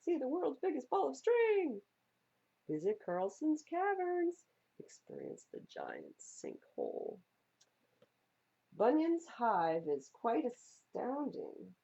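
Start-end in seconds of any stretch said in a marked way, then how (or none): 0.0-4.5 high, loud ad pitch; billboard announcer. Acted. Roadside billboards shouting at drivers, hammed-up ad voice. 4.8-7.2 spooky billboard. Acted. Roadside billboards.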